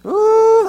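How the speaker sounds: high, loud "ooh" of amusement; mock whoop. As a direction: whoop as if it's too funny. Acting out helpless amusement.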